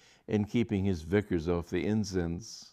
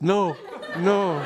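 loud no; 11 semitones higher, 7 dB louder than usual. A narrator's reading. Emphatic refusal.

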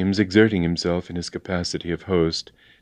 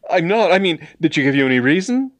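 high, hurried questioning; flustered protest; 10 semitones higher, 5 dB louder, faster than usual. A narrator's reading. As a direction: demand an explanation in agitation. Flustered James demands a reason.

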